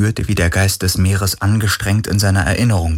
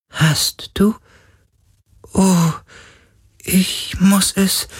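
very high, slow, otherworldly ghost; remembering spirit, drawn out and high; 13 semitones higher, slower than usual. A narrator's reading. Ghost character voice.

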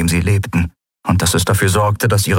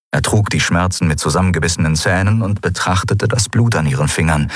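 ordinary delivery

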